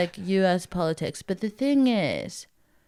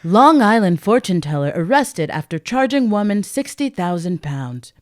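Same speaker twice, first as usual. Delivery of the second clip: mock news anchor delivery; newsreader voice; 6 dB louder than usual. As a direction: read it like a lightly hammed headline. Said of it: "newsreader voice".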